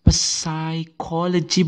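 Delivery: psychology slowly with the P, pesaikolodji; wrong pronunciation. Shown as a demonstration. Teacher contrasting the wrong psychology. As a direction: drag out the P sound as the error.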